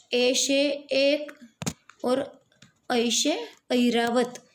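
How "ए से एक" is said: ए से एक said slowly, ए held long; दीर्घ ए खींचकर बोलें. Shown as a demonstration. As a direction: draw out the ए in एक. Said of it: "long vowel है".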